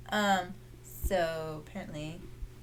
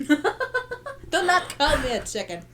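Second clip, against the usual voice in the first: mock protest; 8 semitones higher, 7 dB louder, slower than usual. Friend jokingly calls her chicken.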